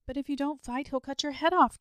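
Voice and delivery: high, loud cry of dismay; gasped alarm. A narrator's reading. Boy fearing for his dragon friend, gasping in alarm. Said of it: "gasped the boy".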